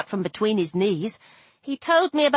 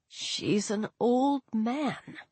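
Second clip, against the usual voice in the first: muttered; 8 dB quieter, much slower than usual. Acted. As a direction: mumble it to yourself, dejected. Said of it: "he muttered".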